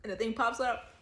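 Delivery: loud, high, laughing retelling; excited laughing. Real, not acted. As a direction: tell it loudly through laughter.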